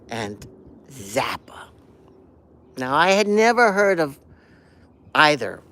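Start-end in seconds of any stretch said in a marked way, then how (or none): 0.1-1.7 whispered name. Real, not acted. Recalling the name hushed.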